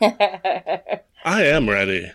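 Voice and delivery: giggling as you answer; laughing. Real, not acted. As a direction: say it through a laugh.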